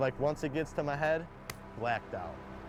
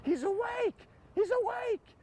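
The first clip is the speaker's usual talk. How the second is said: imitating a very high-pitched screaming nurse; retold scream; slower than usual. Nurse alerting everyone that the patient woke up, mock screaming. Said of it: "I just hear screaming".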